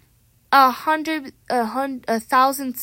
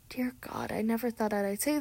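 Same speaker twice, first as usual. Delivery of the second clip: whisper; 9 dB quieter than usual. Retold or imitated. Exhausted after gym class.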